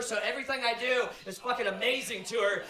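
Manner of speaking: normal talking voice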